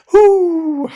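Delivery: loud, high whoo; high whooshing breath to regain control; big release exhale. Retold or imitated. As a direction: blow it all out, retold. Acts managing to let go.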